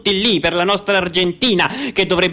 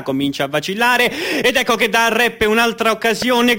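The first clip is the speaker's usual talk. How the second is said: higher, rising match commentary; live play-by-play; 6 semitones higher than usual. Acted. Commentator calling the final's goal, rising to a shout. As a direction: build to the goal call.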